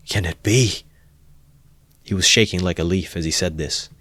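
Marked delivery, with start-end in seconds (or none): 0.1-0.8 horrified. A narrator's reading. Horrified self-questioning.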